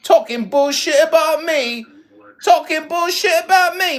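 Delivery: loud scolding; loud accusation. Real, not acted. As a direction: drive the accusation home.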